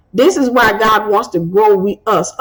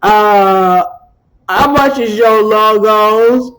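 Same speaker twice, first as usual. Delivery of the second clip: slow, mocking bargain-hunter drawl; cheap customer; slower than usual. Mocking the old cheap customers who called her. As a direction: play the haggling old client. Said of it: "they sounded like this".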